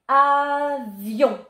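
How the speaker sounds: "avion" with a long first "a", high and loud; sounded out loudly. Shown as a demonstration. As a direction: stretch "avion" out clearly for spelling. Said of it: "toujours avec le son ion".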